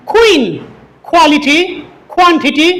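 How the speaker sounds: puffed kh opening "কুইন" and each q word; correct aspirated pronunciation. Shown as a demonstration. Teacher modelling correct Q words for learners, aspirated. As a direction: breathe out on the k of "কোয়ালিটি". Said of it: "শুদ্ধ উচ্চারণ হবে".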